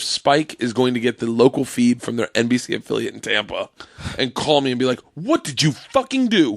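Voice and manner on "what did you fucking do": high, drawn-out outraged demand; mock angry boss. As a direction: play the furious network boss phoning. Imagined angry network boss calling.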